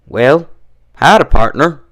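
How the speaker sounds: bright, eager howdy; enthusiastic greeting. A narrator's reading. Applejack greets the inspector, eager and lively. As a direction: greet the visitor like an old friend. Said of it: "she greeted him enthusiastically".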